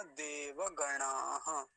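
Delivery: देवगणाः slow, ending in a breathed ha; visarga sounded. Shown as a demonstration. Teacher giving the whole word, visarga clearly sounded. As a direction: draw out देवगणाः and finish on an echoed ha. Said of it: "विसर्ग पर भी ध्यान रखेंगे".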